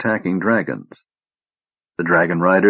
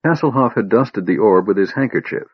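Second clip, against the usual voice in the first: ordinary delivery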